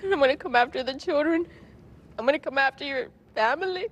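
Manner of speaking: crying while quoting his threats; tearful retelling of his threats and her refusal; real tearful. Real, not acted. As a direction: voice high and strained with tears, partly fast. Recounting order to shoot him.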